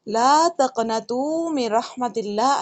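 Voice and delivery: لا تقنطوا من رحمت اللہ slow with long لا; slow correct recitation. Shown as a demonstration. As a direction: recite deliberately, holding لا. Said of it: "نا امید نہ ہونا".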